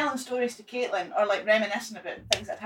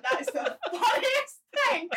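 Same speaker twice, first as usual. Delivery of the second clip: retelling a friend laughing, pitched way up; 13 semitones higher, slower than usual. Retold or imitated. Friend on the floor laughing at him, laughing high. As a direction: squeak it out through laughter, slowly. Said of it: "end of the cell laughing".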